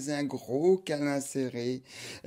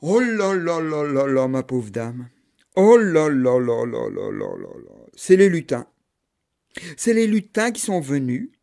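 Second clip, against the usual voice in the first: granny lamenting; 10 dB louder than usual. Acted. Old neighbour pitying the mother, loud lamenting granny. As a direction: wail in pity then reveal.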